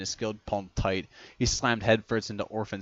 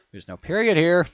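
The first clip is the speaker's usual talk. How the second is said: surprised; 9 semitones higher, 8 dB louder than usual. Real, not acted. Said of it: "commenting on the text".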